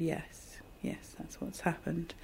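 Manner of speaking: hushed, almost breathless yes; doctor quietly confirming the stroke, hushed; quiet grave confirmation. Retold or imitated. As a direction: soften right down as you confirm bad news.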